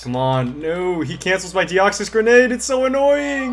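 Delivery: rising frustrated outburst, high and loud; frustrated whine. Real, not acted. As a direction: whine loud about the unfair game. Teammate's grenade got cancelled.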